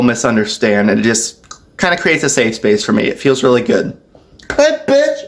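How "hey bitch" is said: sudden high-pitched yelped words; high, abrupt Tourette's outburst; vocal tic. Real, not acted. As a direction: blurt it out involuntarily, very high. Involuntary verbal Tourette's tic.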